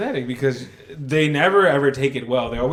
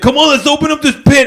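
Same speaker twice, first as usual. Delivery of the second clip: hyped fan; 10 semitones higher, 8 dB louder than usual. Retold or imitated. Imitating the overeager lone fan.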